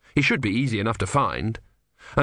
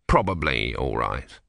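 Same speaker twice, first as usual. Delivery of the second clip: much lower, slow honest hedge; doubtful afterthought; 10 semitones lower, slower than usual. Acted. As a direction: mutter the doubt after a beat. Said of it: "he added".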